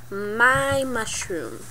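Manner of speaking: very slow, soft, drawn-out words; slow possessive claim. Pretend. Player claiming the mushroom as theirs, slow possessive drawl. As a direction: stretch each word out quietly to stake the claim.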